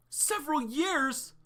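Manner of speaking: loud, high, slow cry of disbelief; can't believe what was just said; shocked disbelief. Acted. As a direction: several years, said with a stunned outcry. Shocked at long training time.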